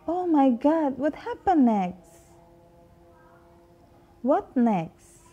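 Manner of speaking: teacher acting surprise for the class to imitate; mock surprise. Shown as a demonstration. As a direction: gasp it out as if astonished. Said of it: "repeat after me".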